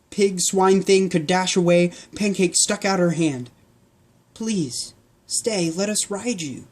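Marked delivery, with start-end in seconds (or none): 4.3-6.7 softer, pleading ask; soft girl pleading; asking softly. Acted. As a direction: coax the pig gently. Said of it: "she asked".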